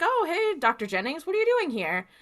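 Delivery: high, lilting friendly surprise; acted surprised greeting. Acting out clerk greeting the doctor.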